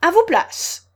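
high, loud roaring command; thunderous. A narrator's reading. Queen sending players to their places, thundering. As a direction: thunder out the order. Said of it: "d'une voix de tonnerre".

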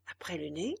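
ordinary delivery, nothing marked

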